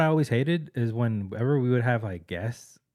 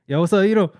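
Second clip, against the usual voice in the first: higher, louder awkward yo; awkward shout back from the stall; awkward greeting; 9 semitones higher, 9 dB louder than usual. Retold or imitated. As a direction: cover embarrassment with a loud hello.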